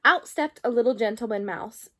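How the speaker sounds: plain reading voice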